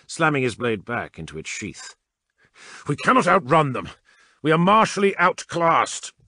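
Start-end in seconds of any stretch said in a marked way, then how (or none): 2.9-6.1 loud and high, close to shouting; frustrated roar. A narrator's reading. Captain roaring at the adept about the battle barge, loud. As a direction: vent the frustration at full voice. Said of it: "Kernan roared in frustration".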